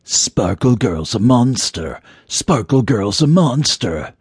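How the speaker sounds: slow, eerie taunting chant; drawn-out, otherworldly taunt; mysterious voice. A narrator's reading. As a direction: repeat it slowly like a haunting accusation. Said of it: "the mysterious voice now repeated".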